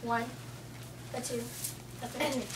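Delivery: normal talking voice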